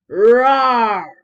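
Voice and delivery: huge loud roar; lion roar. Acted. Lion roaring very loud in the library. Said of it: "Then he roared, very loud".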